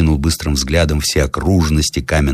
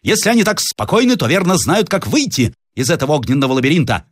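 agitated general; 10 semitones higher than usual. Acted. Story figure: agitated general reasoning aloud.

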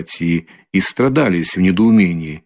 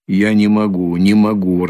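usual speaking voice

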